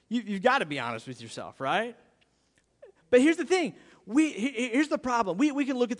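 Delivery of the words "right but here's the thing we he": ordinary talk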